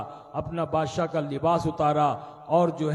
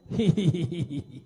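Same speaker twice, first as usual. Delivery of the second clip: put-on slave girl laughing. Slave girl giggles while being whipped. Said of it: "तो हंसने लगी".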